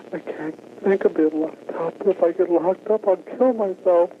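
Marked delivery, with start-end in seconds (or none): none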